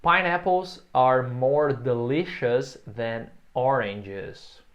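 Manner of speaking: very slow, every word clear for repeating; slow model sentence. Shown as a demonstration. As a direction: spell it out slowly for learners.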